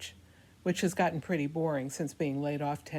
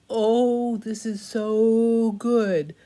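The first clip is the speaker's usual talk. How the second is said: slow, breathy pout, much higher and louder; pouty sexy voice; 6 semitones higher, 7 dB louder, much slower than usual. Acted. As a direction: purr it with pouty lips. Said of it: "making my lips all pouty like Kristi Noem".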